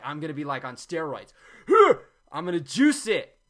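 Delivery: mock-hype yourself up before juicing; pumped-up mock juicer. Imitating a juicer psyching up, excited. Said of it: "You're like".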